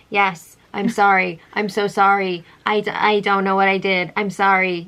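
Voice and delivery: low robot monotone; robotic apology. Retold or imitated. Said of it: "Robot Maddie?".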